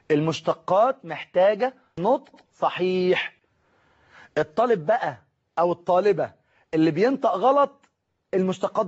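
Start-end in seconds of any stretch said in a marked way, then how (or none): 0.1-3.4 slow and low, each word set apart; deliberate teacherly diction; careful correct articulation. Shown as a demonstration. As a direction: enunciate المشتقات محتاجة نطق صحيح word by word.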